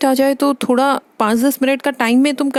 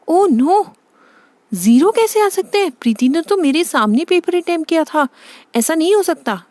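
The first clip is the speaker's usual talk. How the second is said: high, dismayed protest; aghast mother, pitch swooping up; shocked disbelief; 4 semitones higher than usual. Acted. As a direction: refuse to believe the terrible result. Mother shocked by zero marks.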